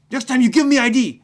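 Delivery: high, loud scolding shout; angry guard warning. Retold or imitated. Security guard warning the student, angry shout. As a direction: wag a finger and yell.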